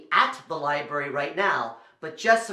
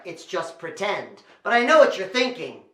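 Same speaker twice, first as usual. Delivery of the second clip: ordinary delivery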